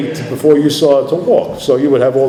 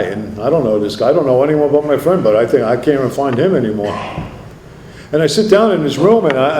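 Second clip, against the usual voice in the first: ordinary talk, nothing marked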